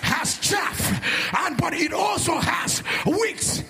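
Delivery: high, forceful shouted sermon; fiery preacher's cry, high and insistent; full-force preaching. Real, not acted. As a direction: drive each line home to the congregation.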